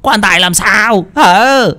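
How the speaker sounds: high, drawn-out angry bawl; slow, high-pitched snarl of impatience; bawling demand. Acted. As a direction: demand an answer at the top of the voice. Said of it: "Ông Bình lại ngoạc mồm".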